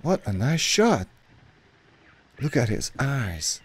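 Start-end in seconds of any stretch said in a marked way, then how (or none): none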